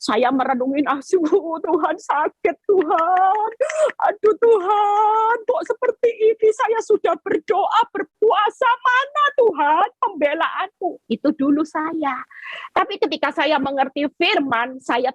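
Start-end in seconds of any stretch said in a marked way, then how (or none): 0.8-11.1 retold weeping prayer. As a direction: lament like you once sobbed. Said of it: "saya nangis bombay".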